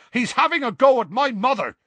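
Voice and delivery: loud, high-pitched accusing shout; indignant yell. A narrator's reading. Seamus complains to Ron about Harry, yelling indignantly. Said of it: "Seamus yelled".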